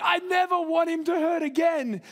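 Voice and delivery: high, pleading parental protest; acted anguished vow.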